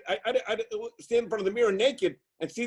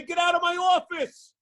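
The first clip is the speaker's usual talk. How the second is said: a louder, higher mock bark at a patient; mock angry surgeon; 9 semitones higher, 4 dB louder than usual. Speaker imitating an orthopedic surgeon dismissing a patient, mock angry. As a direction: play the fed-up surgeon throwing someone out. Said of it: "An orthopedic would say".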